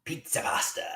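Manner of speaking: whispered name. Acted. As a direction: breathe the name quietly.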